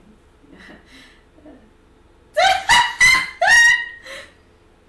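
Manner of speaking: shrieking burst of laughter; loud laughing shriek. Real, not acted. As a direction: howl with laughter. Player cracks up at table.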